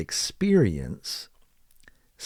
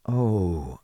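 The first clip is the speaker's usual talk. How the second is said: ordinary talk, nothing marked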